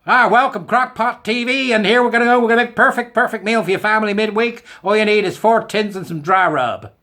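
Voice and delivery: high, loud, hammed-up TV host; mock American food channel voice; American cooking-video host. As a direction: sell it like a cheesy crockpot presenter. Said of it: "they come on and go".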